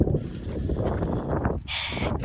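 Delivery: long, soft exhale; upset sigh. Real, not acted. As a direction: let out a long weary breath. Upset over the broken truck.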